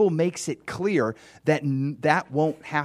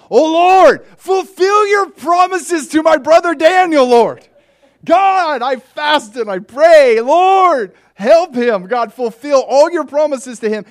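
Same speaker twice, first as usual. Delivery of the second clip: high, loud impassioned pleading to God; fervent prayer; 13 dB louder, slower than usual. Acted. Chad praying to God for Daniel in a high, loud fervent cry. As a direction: cry out to the Lord with everything.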